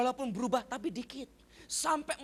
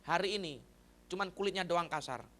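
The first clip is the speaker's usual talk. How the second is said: ordinary talk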